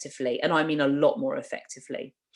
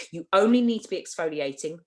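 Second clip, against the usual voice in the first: ordinary talk, nothing marked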